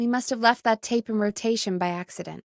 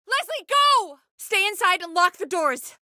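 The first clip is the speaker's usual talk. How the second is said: sharp high urgent cry to the caller; 5 semitones higher than usual. Acted. Host urging the caller to flee the killer, urgent high cry. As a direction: yell at her to run now.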